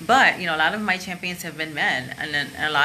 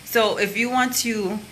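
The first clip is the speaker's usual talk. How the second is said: ordinary delivery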